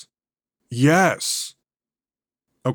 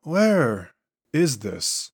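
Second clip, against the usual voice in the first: very slow, alien entity voice; otherworldly voice; 2 semitones higher, much slower than usual. Acted. The entity possessing [name] asking where it is, slow and alien. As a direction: speak as an ancient being waking in a stranger's body. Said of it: "[name]'s voice and inflections are noticeably different".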